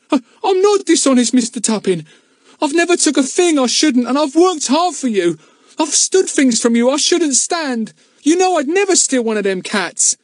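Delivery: put-on wronged boy defending himself; desperate protest. A narrator's reading.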